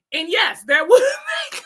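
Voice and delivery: high, loud words through real laughter; can barely get it out for laughing; laughing hard. Real, not acted. Speaker cracking up at coworker's idea, laughing loud. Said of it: "couldn't stop laughing".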